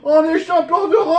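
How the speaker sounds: shouting through happy tears; tearful shout. Real, not acted. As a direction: cry out with overwhelmed joy. Overwhelmed fan shouting.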